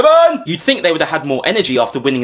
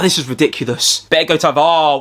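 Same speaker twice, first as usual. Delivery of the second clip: ordinary talk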